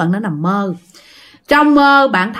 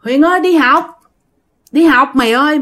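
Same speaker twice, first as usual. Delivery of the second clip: calling out; 5 semitones higher than usual. Acted. Friends calling Huyền to school at night, calling out. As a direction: sing out the name as if from outside. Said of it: "là nghe tiếng kêu".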